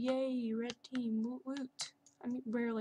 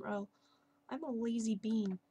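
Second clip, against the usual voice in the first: ordinary delivery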